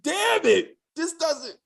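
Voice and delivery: high, frustrated outburst; exasperated outburst. Retold or imitated. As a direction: squeal your frustration at the screen. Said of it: "I was like".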